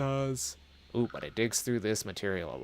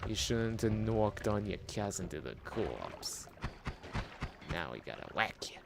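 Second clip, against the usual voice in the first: put-on New York accent; 4 dB quieter than usual. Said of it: "Talking like I'm from frickin' New Nor".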